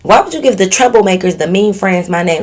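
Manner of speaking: playful offended questioning; mock indignation. Real, not acted. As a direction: object as if personally wronged. Objects to her name in letter.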